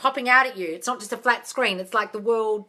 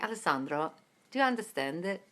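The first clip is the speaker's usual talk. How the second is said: put-on low, soft Italian-accented English; imitated Italian accent; 5 semitones lower, 9 dB quieter than usual. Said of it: "an Italian accent".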